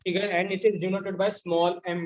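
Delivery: slow, spaced-out dictation voice. Shown as a demonstration. Teacher dictating the definition to the class, slow and spaced. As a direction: read it out slowly so students can write.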